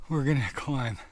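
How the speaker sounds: ordinary delivery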